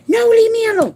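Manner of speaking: high, loud mock protest of an old woman; hammed-up elderly lady shouting no; grouchy old lady refusing. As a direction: push her away in her yelling voice. Old lady refusing hug, retold.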